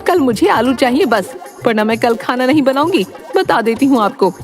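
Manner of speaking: high-pitched, exasperated nagging; fed-up wife. Acted. Wife threatening no dinner over his miserliness, fed up.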